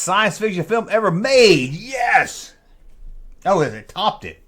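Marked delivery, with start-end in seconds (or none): none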